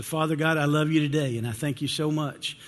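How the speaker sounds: earnest, softening spoken prayer. Acted. Pastor leads opening prayer.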